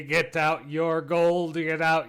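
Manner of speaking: booming, slow, drawn-out announcer; fighting game announcer. Acted. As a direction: hype up the crowd, slowly. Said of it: "an announcer voice emanates".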